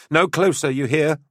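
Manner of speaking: high, loud warning call. Acted. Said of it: "she called out".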